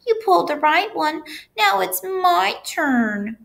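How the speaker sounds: high-pitched, pleading little whine; whining. A narrator's reading. Pigtail wanting its turn to be pulled, whining. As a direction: complain high, dragging out the end. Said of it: "whined the pigtail".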